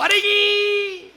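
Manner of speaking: high loud drawn-out yelp; stretched alarmed shout. Retold or imitated. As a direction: hold the alarmed yelp long.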